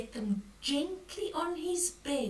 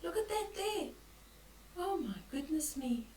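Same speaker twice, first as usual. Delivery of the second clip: higher, softer pretend wonder; mock amazement; 5 semitones higher, 7 dB quieter, faster than usual. Granny marvelling at a silly picture, higher.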